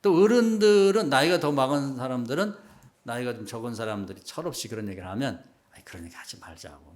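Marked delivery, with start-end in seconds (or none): 5.7-6.9 very soft, low mild admonition; gentle dissuading. Retold or imitated. Older person to younger ones talking thoughtlessly, softly dissuading. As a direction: quietly rein them in.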